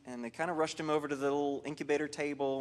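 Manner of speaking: ordinary talk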